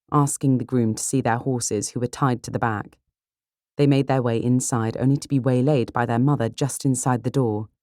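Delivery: usual speaking voice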